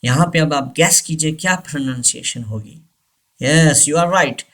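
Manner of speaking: usual speaking voice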